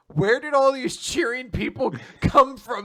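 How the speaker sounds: loud, high incredulous question; acted bafflement with a laugh inside; mock bewilderment. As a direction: demand an explanation in comic disbelief. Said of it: "Someone just says".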